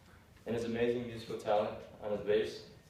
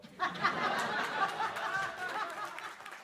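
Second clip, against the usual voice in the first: high laugh. Real, not acted. Laughs after quoting comment.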